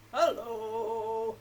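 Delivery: big, drawn-out low hello. Shown as a demonstration. Storytime host asks children for lowest voices, low and loud. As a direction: push out your lowest voice, loudly. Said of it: "get your lowest voice out".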